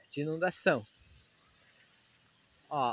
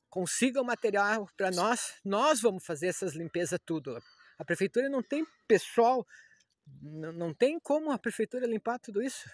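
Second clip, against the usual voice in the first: angry; 5 semitones higher, 5 dB louder than usual. Real, not acted. Outraged appeal to city hall.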